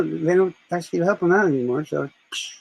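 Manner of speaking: usual speaking voice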